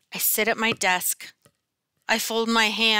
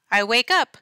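ordinary delivery, nothing marked

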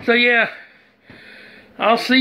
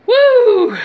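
laughing whoop; 14 semitones higher, 7 dB louder than usual. Real, not acted. After failed pepper challenge.

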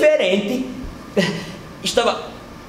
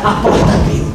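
ordinary talk, nothing marked

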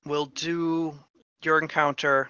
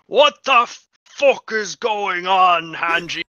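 loud, higher-pitched roar with drawn-out words; booming voice; 3 semitones higher, 10 dB louder, slower than usual. Acted. Voice from the trees calls out to Hanji, booming. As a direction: boom it out from afar, outraged. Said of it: "a booming voice, that goes".

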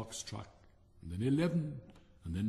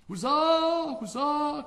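high, loud cheer; a royal court cheering the fireworks; 8 dB louder, much slower than usual. Acted. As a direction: cheer at the top of your voice. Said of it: "cried the court".

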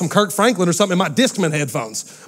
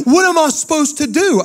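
put-on whiny kid; 9 semitones higher than usual. Kids begging Daddy about the Wi-Fi, high whiny child voice. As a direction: plead like a panicked little kid. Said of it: "kid's reply in the dialogue".

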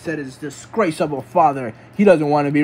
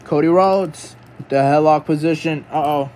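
ordinary talk

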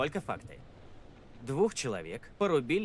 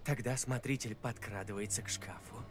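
ghost story; 4 dB quieter than usual. Acted. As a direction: drop the voice, draw it out. Tells a spooky tale.

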